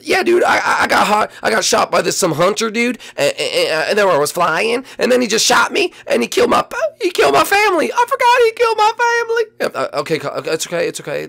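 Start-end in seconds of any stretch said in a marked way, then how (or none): 0.0-9.4 high, louder wailing, building to a sob; a hammed-up sobbing bird; crying bird voice. Bird tells rescuers a hunter killed family, hammed-up sobbing. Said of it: "that's actually really sad".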